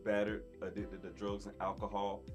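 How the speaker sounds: ordinary delivery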